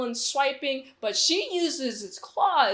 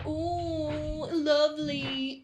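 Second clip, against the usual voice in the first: a hammed-up swooning couple, pitched higher; mock lovey-dovey; 4 semitones higher, slower than usual. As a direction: tease the romance with a syrupy voice. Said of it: "having a lovey-dovey, handy-dandy moment".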